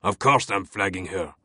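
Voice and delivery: put-on understated French-accented snap; snapped. A narrator's reading. Captain overruling his lieutenant's objection, snapping. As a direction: cut off the objection tersely. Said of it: "Fache snapped".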